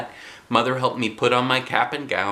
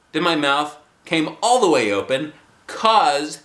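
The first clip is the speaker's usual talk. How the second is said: ordinary delivery, nothing marked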